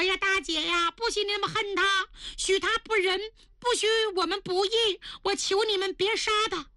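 put-on high girlish voice, begging; pleading young lady. A narrator's reading. Lili begs her elder sister not to kill Yanyan, high and pleading.

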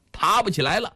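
plain reading voice

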